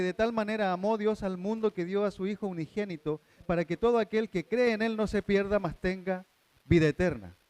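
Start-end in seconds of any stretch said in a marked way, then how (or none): 3.5-7.4 louder, solemn recitation; scripture reading. Acted. Preacher reading John three sixteen aloud. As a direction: proclaim the verse.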